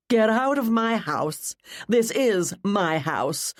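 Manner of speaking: slow, emphatic order to leave; angry dismissal. A narrator's reading. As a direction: stretch each word as a haughty command. Rebecca orders her rival out.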